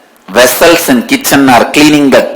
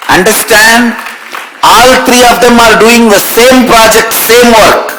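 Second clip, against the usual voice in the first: emphatic exclaim; 9 semitones higher than usual. Real, not acted. Guru exclaiming same project.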